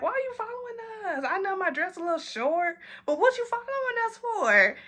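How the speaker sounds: put-on high, soft indignant girl voice; mock indignant. Girls asking the follower why he's trailing them, high mock indignation. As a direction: play offended, pitch way up.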